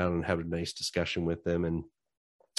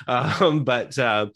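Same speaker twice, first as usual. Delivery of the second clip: teary laugh; 4 semitones higher, 6 dB louder, much slower than usual. Real, not acted. Laughs through emotion.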